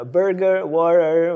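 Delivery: loud, over-pronounced برجر and وورر, imitating an audition reading; showy English pronunciation. Actor showing auditioners his English, over-pronounced برجر and وورر. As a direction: hit برجر and وورر loudly, showing off each English sound. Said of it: "أهم شيء البرونسيشن".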